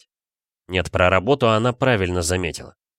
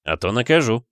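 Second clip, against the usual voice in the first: playful threat; 3 semitones higher than usual. Acted. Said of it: "пригрозил".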